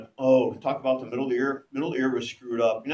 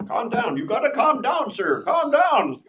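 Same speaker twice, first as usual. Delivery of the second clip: higher, louder, a hint of a firm instructor; instructor calming him; 9 semitones higher, 7 dB louder than usual. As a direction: urge him to calm down, half-mocking. Instructor soothing panicking student.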